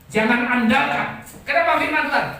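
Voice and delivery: loud, high-pitched sermon cry; impassioned preacher in full flight; full-force preaching. Real, not acted. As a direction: proclaim it at the top of your voice.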